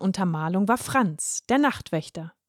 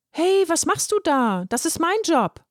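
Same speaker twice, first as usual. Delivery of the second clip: indignant outcry; 7 semitones higher than usual. Acted. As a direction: snap at the stranger who took his job. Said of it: "rief er empört". (empörter, hoher Ausruf)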